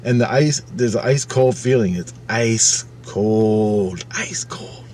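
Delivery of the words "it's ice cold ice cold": drawn-out, chilling whisper; slow dramatic whisper for suspense; eerie hush. Retold or imitated. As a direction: stretch it out, fading into a whisper. Dramatizing the icy feeling.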